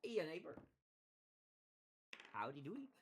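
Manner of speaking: lightly hammed-up Midwestern accent; mock Midwestern greeting. Midwesterner greeting a neighbor, mock Midwestern niceness. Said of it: "call everybody neighbor".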